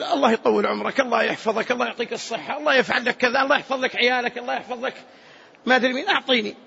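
acted pleading patter of blessings; humble beggar. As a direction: play a poor man softening a donor. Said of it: "لما يظهر فقره ومسكنته". (تمثيل مسكين يتذلل في السؤال)

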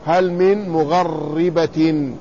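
very slow مغربة as "mugharribatin", held rolled ra; doubled ra with kasra. Shown as a demonstration. Teacher demonstrates the scholars' reading, doubled ra with kasra. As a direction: slowly say مغربة with a after ghayn and doubled ri. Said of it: "وكسروا الراء وشددوها".

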